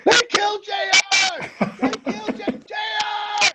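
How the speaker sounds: very high, frantic shriek; panicked shout. Retold or imitated. Tori screams as his friend is stabbed, very high panicked shriek. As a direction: scream it in terror. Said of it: "Tori's like".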